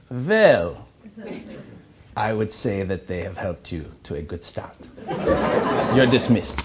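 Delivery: German dean. Retold or imitated. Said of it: "delightful elderly German woman".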